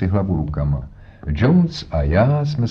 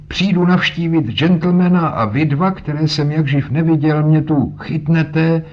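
put-on indignant old man; 8 semitones higher, 3 dB louder than usual. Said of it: "zvolal a třískl holí".

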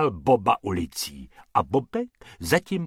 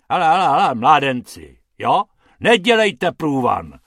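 loud, cross telling-off; grouchy busker shouting them off; irritated growl; 3 semitones higher, 8 dB louder than usual. A narrator's reading. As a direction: shoo the youngsters away crossly. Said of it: "zavrčel pan Hrubec".

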